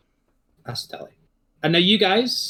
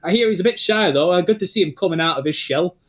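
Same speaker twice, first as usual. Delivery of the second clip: Northern English comic voice; 3 semitones higher than usual. Acted. Commentator Larry joking to the arriving students, Northern English voice. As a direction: crack wise like a Northern English announcer. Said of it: "It's like".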